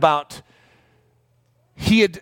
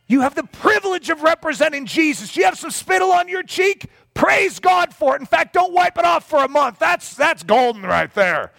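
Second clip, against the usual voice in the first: imagined [name]; 11 semitones higher than usual. Retold or imitated. Imagined [name] exhorts the preacher himself, high and hammed-up. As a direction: preach it at him half-shouting, mock-fervent. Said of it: "[name] was slapping my cheeks".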